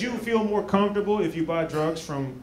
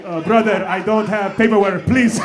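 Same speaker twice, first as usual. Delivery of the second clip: put-on loud pleading in African accent; Benga's mock accent; 6 dB louder than usual. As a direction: imitate a stressed African man, loudly protesting. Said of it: "He's like".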